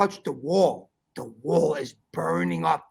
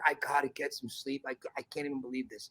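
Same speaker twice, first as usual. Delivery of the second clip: exhausted mutter; 5 semitones lower, 12 dB quieter, faster than usual. Retold or imitated. Exhausted, overwhelmed at 5am.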